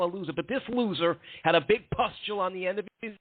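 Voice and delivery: high-pitched split-personality character voice, boasting; Electric Eddie. Acted. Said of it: "subtle Electric Eddie voice".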